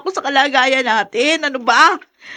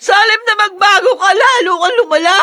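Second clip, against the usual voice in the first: louder, crying through the words; tearful reproach; 8 semitones higher, 5 dB louder than usual. Acted. Wife weeps at husband who only got worse, crying reproach. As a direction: sob out your disappointment.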